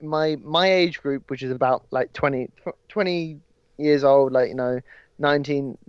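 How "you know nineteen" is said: normal talking voice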